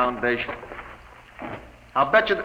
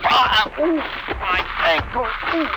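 pained cry; 10 semitones higher, 3 dB louder than usual. Acted. Hurt ankle, crying out in pain.

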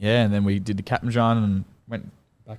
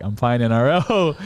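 higher, slow, drawn-out retold announcement; elated announcement; 4 semitones higher, 4 dB louder than usual. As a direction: repeat the big news over a noisy family. Repeats news over loud family.

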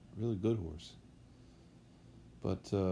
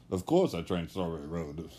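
lightly put-on French accent, a bit lower; 4 semitones lower than usual. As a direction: imitate the French trainer in a mild French accent. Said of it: "in his French accent".

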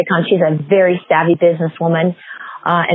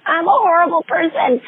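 much higher, wailing self-blame; self-reproach; 12 semitones higher, slower than usual. Retold or imitated. Instructor berating herself after the bad news, high mock wail. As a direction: cry out at yourself in shame. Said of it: "And I'm like".

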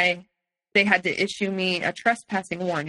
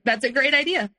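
sarcastic retort; 6 semitones higher than usual. Retold or imitated. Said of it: "I was like".

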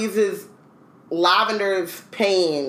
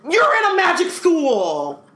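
high, slow, loud mock exasperated shout; high drawn-out shout of mock disbelief; mock outrage; 12 semitones higher, 4 dB louder, slower than usual. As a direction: yell it at the wizards in disbelief, much higher. Mock outrage at wizards' fraud.

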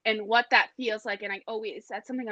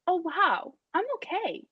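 higher-pitched, acted realization; mild amazement; 8 semitones higher than usual. Client standing up after the struggle, mild acted amazement. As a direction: play a dawning discovery that you're fine.

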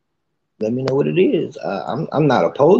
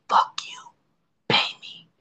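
whispered mock defiance; 6 dB quieter than usual. Voicing the blunt narcissist side.

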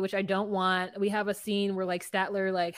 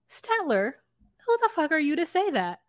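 higher-pitched indignant retort; indignant challenge; 6 semitones higher than usual. Retold or imitated. Viewer retelling reaction to Statler, indignant challenge. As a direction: pause on the name, then challenge in disbelief.

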